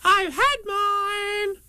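very high, drawn-out little squeal; proud Roo. A narrator's reading. Roo boasting about his medicine, high proud voice. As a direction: pipe up slowly, bursting with pride. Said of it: "Roo said proudly".

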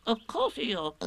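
high little piping reply; Piglet. A narrator's reading. Piglet confirms to Eeyore, high and small. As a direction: answer quickly in a tiny voice.

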